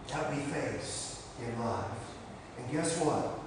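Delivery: ordinary talk, nothing marked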